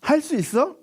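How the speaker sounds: 높이 올려 솔깃하게 묻기; 여지를 주는 예수님의 질문 흉내; 기대에 찬 질문. Retold or imitated. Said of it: "라고 물어봤단 말이야". (높고 솔깃한 물음)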